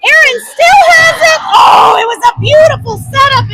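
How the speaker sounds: excited call. Real, not acted. Tense attack in play.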